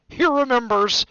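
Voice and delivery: loud, high, delighted outburst; excited cry. A narrator's reading. Diggle telling everyone around, loud thrilled cry. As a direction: cry it out to the whole room. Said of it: "cried Daedalus Diggle".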